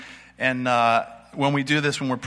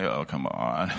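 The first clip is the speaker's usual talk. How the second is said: sceptical scoff; 10 semitones lower, 3 dB quieter, slower than usual. Retold or imitated. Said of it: "they're like".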